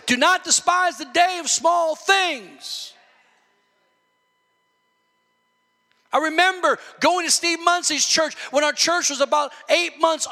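loud, high, impassioned sermon delivery; full-force preaching. Real, not acted. Preacher urging the church not to despise small things, fired-up preaching. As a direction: preach it hard, stretching key words into near shouts.

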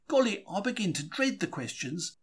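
usual speaking voice

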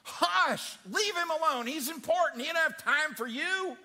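loud, high scornful shush; scolding crowd. Acted. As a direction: shut the beggar down hard. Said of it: "Could you imagine this crowd?".

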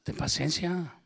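quoting her calmly. Retold or imitated.

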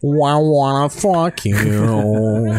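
drawled slow mock dub line; slow goofy dub voice. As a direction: ham up a dopey voice over the scene. Dubbing over movie.

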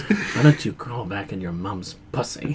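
low, soft, slow hint of an Indian accent; quiet Indian accent. Scammer insulting him, quiet low Indian accent. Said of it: "real quiet in this Indian accent".

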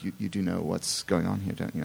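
low, hushed imitation of British staff; softly voiced British official; British casting director. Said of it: "British casting directors are all coming up and saying".

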